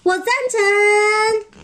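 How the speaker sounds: high, long-drawn cheer; group cheer. Acted. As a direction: stretch the vote out like a whole chorus. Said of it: "大家就举手说".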